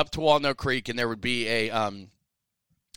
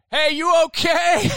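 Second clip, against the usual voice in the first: high, loud, drawn-out holler; yelling across; 9 dB louder, slower than usual. Retold or imitated. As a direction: imitate teammates shouting across a ball field. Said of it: "they're yelling across to me".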